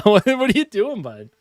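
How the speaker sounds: amused high voice to the cat; pet talk. Real, not acted. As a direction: laugh and coo at the kitty. Laughing at cat by monitor.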